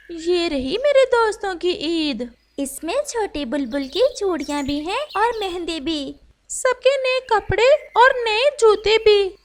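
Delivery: lightly voiced bird friend, rising with joy; proudly presenting the gifts; a friend handing over presents happily; cheerful friend. Friends bring Eid gifts.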